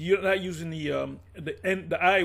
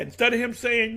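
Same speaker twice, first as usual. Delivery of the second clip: usual speaking voice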